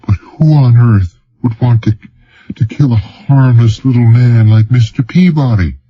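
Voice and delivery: a narrator's gentle voicing of an upset woman; hint of a worried woman; Marika voiced. A narrator's reading. Marika's lines, higher voice.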